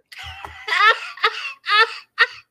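high, helpless laughing fit. Real, not acted. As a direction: crack up until near tears. Laughing fit after fruit joke.

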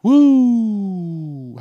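long, loud high whoop; excited whoop. Real, not acted. Host restarting the show for listeners, excited whoop. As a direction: let out a drawn-out falling woo.

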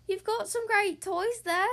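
friendly little wiener dog, slowly; sweet, slow storybook dog voice; gentle Trevor. Acted. Trevor the dog speaks to Pig.

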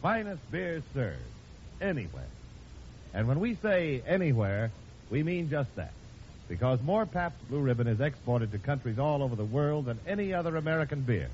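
ordinary talk, nothing marked

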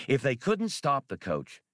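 ordinary delivery, nothing marked

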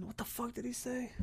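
high, soft baffled whisper. Real, not acted. Vlogger whispering, confused by a big word. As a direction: mutter it in confusion.

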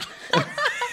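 high burst of laughter. Real, not acted. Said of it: "Isn't that incredible".

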